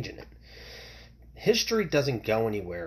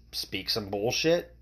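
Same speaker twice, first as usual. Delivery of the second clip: ordinary talk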